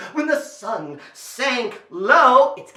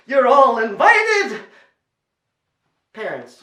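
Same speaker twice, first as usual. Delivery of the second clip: ordinary delivery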